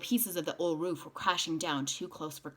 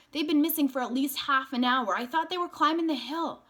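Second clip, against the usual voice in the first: worried; 9 semitones higher, 5 dB louder than usual. A narrator's reading. Worried woman voiced.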